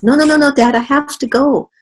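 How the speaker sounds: put-on high, loud pleading little kid voice; baby mosquito voice. Baby mosquito begging his dad, high childish voice. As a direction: beg like a tiny child.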